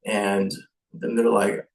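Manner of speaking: ordinary talk, nothing marked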